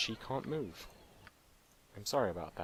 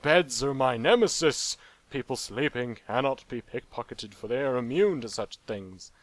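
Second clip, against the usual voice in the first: character's mock proclamation; 3 semitones higher, 8 dB louder than usual. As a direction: declare it like a wounded knight. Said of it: "my character's just like".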